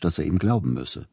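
plain reading voice

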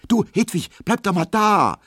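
sehr hoch und laut gerufen; rufen. A narrator's reading. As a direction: ruf sie aufgeregt zurück. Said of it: "ruft Emma". (Emma ruft Hedwig hoch und laut zurück)

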